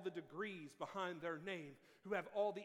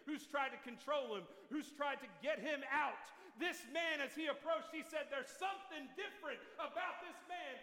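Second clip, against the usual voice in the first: rising, louder preaching build; 5 semitones higher, 4 dB louder than usual. Acted. Preacher building to his congregation, rising and louder. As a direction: preach it with growing fire.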